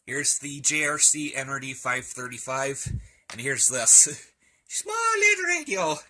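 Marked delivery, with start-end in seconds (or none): 4.7-6.0 squeaky high voice for the small radio; tiny voice. Pretend. Comparing the tiny radio to a big receiver, squeaky high voice. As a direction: make it sound cute and tiny, pitched way up.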